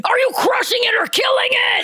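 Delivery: high, shouted class call-out; screaming spin teacher. Acted. Teacher storms into the spin class, high screaming. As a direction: yell it like a fired-up instructor. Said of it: "And then the teacher comes in".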